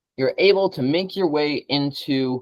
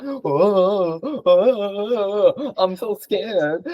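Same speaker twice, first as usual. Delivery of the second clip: sarcastic fake whimper; mock fear; 4 semitones higher, much slower than usual. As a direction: pretend to be terrified. Mocking a threat.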